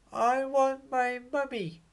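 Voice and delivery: very high, small voice wailing for mummy; whiny baby owl. Acted. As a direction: play a tiny owlet who misses his mum. Baby owl Bill cries for mum.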